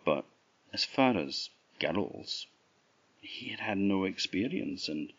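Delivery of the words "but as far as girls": usual speaking voice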